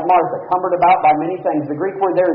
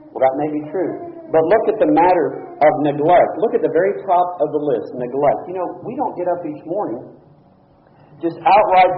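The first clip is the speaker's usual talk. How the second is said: usual speaking voice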